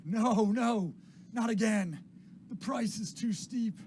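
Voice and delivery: high, loud cries of alarm, drawn out at the end; agitated old William. Acted. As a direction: wail it like a distraught drunk. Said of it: "William is like".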